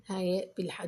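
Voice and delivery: ordinary talk, nothing marked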